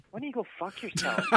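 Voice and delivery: high-pitched feisty retort; retold comeback. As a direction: fire it back at a heckler. Acted comeback to a heckler.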